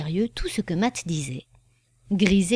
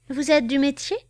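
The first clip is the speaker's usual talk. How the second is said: sly question, pitched higher; mischievous; 7 semitones higher than usual. Acted. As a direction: tease her with a knowing question. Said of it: "demanda Matt malicieusement". (question malicieuse, voix plus aiguë)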